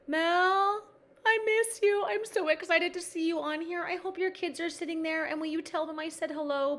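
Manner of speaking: high, gushing excited greeting; thrilled to spot a long-missed friend. Real, not acted. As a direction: squeal a long-lost friend's name, then gush on high. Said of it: "I just got excited".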